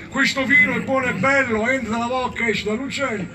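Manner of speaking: put-on high comic drunken reveller; drunken toast. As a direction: recite a bawdy tavern toast. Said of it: "Ubriachi brindarono fino a notte fonda".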